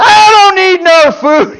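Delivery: a hammed-up, fired-up shout from the pulpit. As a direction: belt it out like the Lord is fired up and waving off the food. The Lord got a little bit fired up there.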